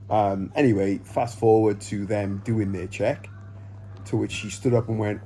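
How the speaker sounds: ordinary talk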